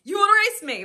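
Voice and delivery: put-on husband laughing off the child's race dare; mock husband. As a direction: scoff at the boy's challenge. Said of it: "that's my husband's doing".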